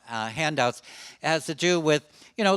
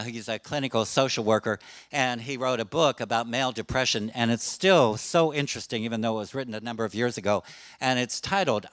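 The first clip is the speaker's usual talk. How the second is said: ordinary talk, nothing marked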